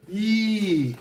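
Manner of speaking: high squeal of panic; panicked squeal. Real, not acted. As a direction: squeak in sudden worry. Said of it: "I'm panicking".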